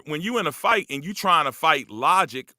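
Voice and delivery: usual speaking voice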